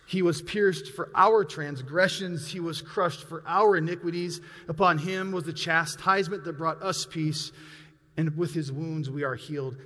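solemn recital of the prophet's words; scripture reading. Acted. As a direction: lay each line down with weight. Reads Isaiah scripture.

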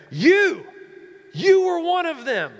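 "You" shouted high and loud, pointing at him; emphatic accusation. Acted. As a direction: accuse him loudly in front of everyone. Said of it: "it's very emphatic what she's saying".

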